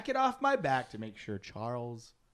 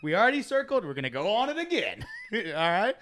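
laughing; 5 semitones higher, 5 dB louder, faster than usual. Real, not acted. Laughing restart of story.

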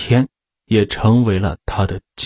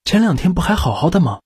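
higher and fast, alarmed; hurried shocked question; 6 semitones higher, faster than usual. A narrator's reading. Agent shocked at the news of the wife, hurried alarm. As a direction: rush the question out in disbelief. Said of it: "急忙问道".

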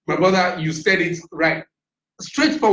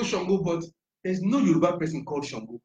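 normal talking voice

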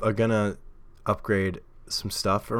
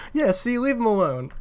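much higher and louder, hammed-up protective voice; mock defending; 7 dB louder than usual. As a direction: stick up for him in a high, loud mock voice. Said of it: "I just wanted to be like".

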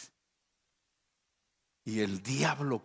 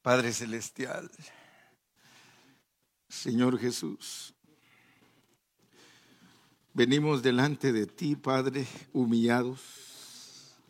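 fervent prayer; 4 semitones higher, 5 dB louder than usual. Real, not acted. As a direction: pray aloud, rising to a cry. Leads a congregation prayer.

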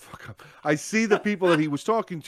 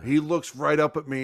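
ordinary talk, nothing marked

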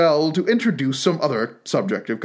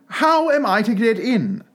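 raised-pitch, insistent question; repeated aloud; 8 semitones higher than usual. A narrator's reading. Alice repeats her question to the footman, aloud. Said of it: "she repeated aloud".

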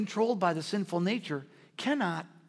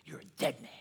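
Jezebel's threat echoing; 5 semitones lower, 7 dB quieter than usual. Retold or imitated. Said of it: "All he could hear was".

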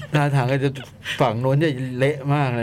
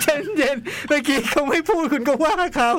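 mock teasing; 12 semitones higher than usual. Co-host mock-scolds the host about interrupting, high teasing voice. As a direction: tease him in a much higher put-on voice.

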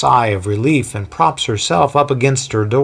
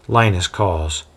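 ordinary delivery

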